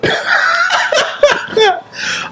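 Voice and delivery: high wheezy laugh. Real, not acted. Laugh again.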